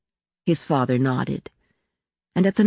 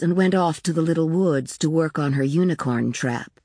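plain reading voice

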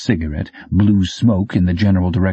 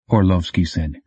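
plain reading voice

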